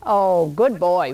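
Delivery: ordinary talk, nothing marked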